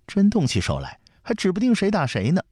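high-pitched, unafraid comeback; defiant. A narrator's reading. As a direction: answer back as a girl who won't give in. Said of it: "不服气地说". (不服气地顶嘴)

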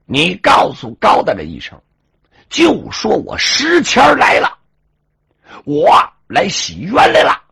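proud hammed-up yell; big shout. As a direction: bellow it across the yamen gate. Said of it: "石谦大喊一声".

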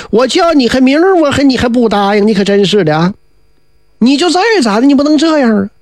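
a wheedling schemer feigning hurt; aggrieved whining fellow. Acted.

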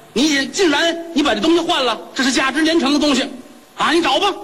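high, shrill-pitched outraged complaint; indignant accusation. Acted. Wife accuses shopkeeper of swapping pearl.

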